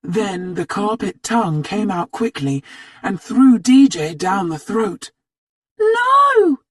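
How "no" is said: high, loud anguished scream; a girl screaming as her friend falls; desperate cry. A narrator's reading. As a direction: scream as your friend is swallowed. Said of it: "cried Jenny".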